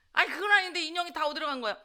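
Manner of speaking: rushed, insistent questioning; whining protest. Retold or imitated. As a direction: re-enact pestering mom for an answer. Acted protest to mom about dolls.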